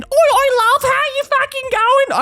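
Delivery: high, slow broad Aussie bogan voice; hammed-up bogan Australian accent. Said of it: "a bogan Australian accent is not attractive".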